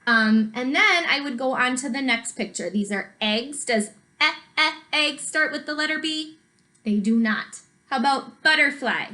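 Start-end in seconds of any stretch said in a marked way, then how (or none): 4.2-5.2 "e, e" as short separate vowel sounds before "egg"; phonics-style short "e, e" leading into "egg"; sounding out. Shown as a demonstration. Sounding out first letter.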